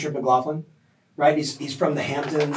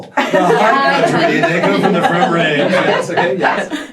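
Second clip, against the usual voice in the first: mock local lingo; 3 semitones higher, 7 dB louder than usual. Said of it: "That sounds very local".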